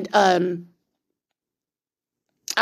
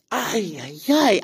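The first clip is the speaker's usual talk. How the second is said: rising groan of disbelief; exasperated groaning ay; slower than usual. Real, not acted. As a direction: sigh it out in exasperation. Exasperated at prank.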